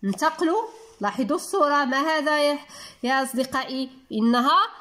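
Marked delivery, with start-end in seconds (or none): none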